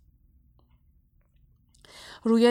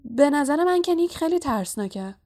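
higher-pitched, hurried-sounding cover-up; flustered; 6 semitones higher, 3 dB louder than usual. A narrator's reading. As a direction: blurt it out to help a friend. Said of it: "با دست پاچگی گفت". (دستپاچه)

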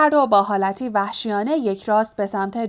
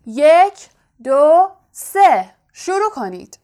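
loud, high, drawn-out countdown; shouted count; 6 semitones higher, 7 dB louder, slower than usual. A narrator's reading. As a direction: call the start out to the hall. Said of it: "فریاد زد". (با فریاد و کشیده)

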